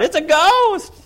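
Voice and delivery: high-pitched, loud panicked scream of a ghost; John's scream. Retold or imitated. John screams to the boat, high-pitched panicked shriek. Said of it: "So he's screaming out".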